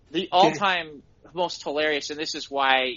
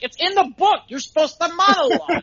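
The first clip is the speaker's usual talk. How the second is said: excited insistence; 12 semitones higher, 6 dB louder than usual. Retold or imitated. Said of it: "I'm like".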